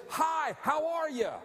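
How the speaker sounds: high, loud bright hello; cheerful greeting. Retold or imitated. Healed leper greeting townsfolk: loud cheerful hello. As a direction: greet people with joy now. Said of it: "I say".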